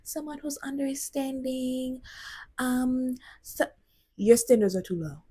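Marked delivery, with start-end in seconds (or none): 0.0-3.7 soft, higher, drawn-out mocking imitation; mimicked dating-show women. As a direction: mimic a hesitant contestant, slow and quiet. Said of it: "y'all sound the exact same".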